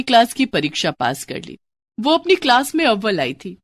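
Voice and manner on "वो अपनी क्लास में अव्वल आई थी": usual speaking voice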